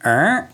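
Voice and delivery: short rising creak imitation; creaking step noise. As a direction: make the stair creak with your voice. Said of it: "I go like this".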